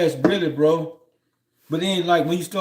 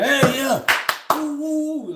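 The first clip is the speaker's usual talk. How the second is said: excited cheering; 10 semitones higher, slower than usual. Retold or imitated. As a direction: whoop it up like a fan in the stands. Cheering alone at a basketball game.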